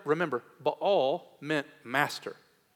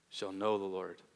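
lower, much softer "Shall know the Lord"; soft, lowered repetition; quiet echo; 6 semitones lower, 7 dB quieter than usual. Shown as a demonstration. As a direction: breathe "Shall know the Lord" as a hushed afterthought. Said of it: "And you shall know the Lord".

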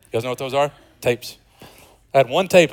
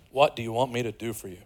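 gentle; 4 semitones lower, 9 dB quieter than usual. Acted. Jesus voiced gently.

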